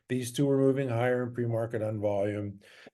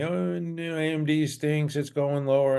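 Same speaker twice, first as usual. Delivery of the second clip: higher-pitched imitation of complainers; put-on whiny complaint, raised in pitch; mock griping; 4 semitones higher than usual. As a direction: mimic people griping at you. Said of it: "some people griping to me".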